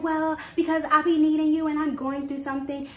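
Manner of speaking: high, loud whiny imitation of the friend; mocking the friend. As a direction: mimic her self-pitying excuse.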